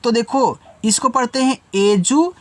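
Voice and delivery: ordinary talk, nothing marked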